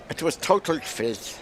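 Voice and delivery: put-on slow, light Irish accent. Commentator mocking a bad ball in a slow Irish accent. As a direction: slip into a lazy Irish accent. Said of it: "Don't know why I did that in an Irish, Irish accent".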